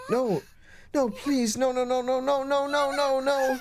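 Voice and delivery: high, rapid frightened no's; panicked pleading. Acted. Mayu, alone in the dark, begs in panicked fear. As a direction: beg it like something terrible is coming.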